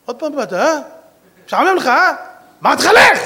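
high, loud rebuke building to a shout; scold, then yell him away; exasperated scolding. Retold or imitated. Rabbi scolds returning angel.

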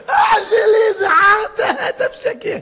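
high, loud shout through laughter; mocking outburst. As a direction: jeer at the fakery, cracking up. Mocking fake table manners. (צעקה לעגנית מתוך צחוק)

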